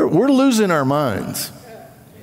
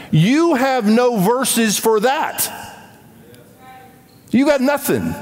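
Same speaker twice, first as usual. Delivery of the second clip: emphatic retort; 8 semitones higher than usual. Real, not acted. Retort to critics.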